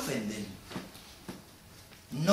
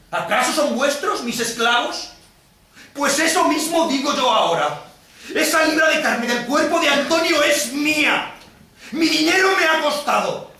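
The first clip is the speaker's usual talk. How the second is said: high, loud, furious claim; outraged demand; 9 semitones higher, 6 dB louder than usual. Acted. Moneylender claims Antonio's pound of flesh before Venice, outraged demand. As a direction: cry out for what is owed.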